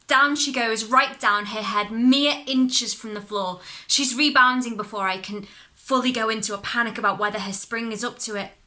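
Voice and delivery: ordinary delivery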